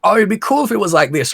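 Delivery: high, quick, airy dreaming; light mock of a casual hypothetical; musing Yoshida. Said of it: "every time that he's saying".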